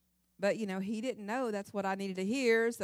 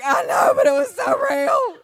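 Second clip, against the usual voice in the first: much higher, louder mock wail; hysterical wail; 11 semitones higher, 12 dB louder, slower than usual. Wife wailing to husband about her dream, hammed-up shout. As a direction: shriek it like a frantic dream-shaken wife.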